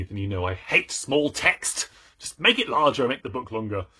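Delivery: ordinary talk, nothing marked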